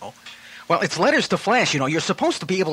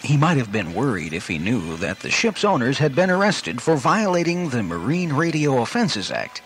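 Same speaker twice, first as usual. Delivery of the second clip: put-on dramatic old-time radio narrator over music; radio serial narration; 4 semitones lower than usual. Announcer setting the shipwreck scene in serial narration. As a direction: announce the hero's peril like a serial.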